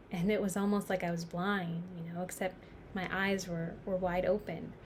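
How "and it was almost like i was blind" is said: ordinary delivery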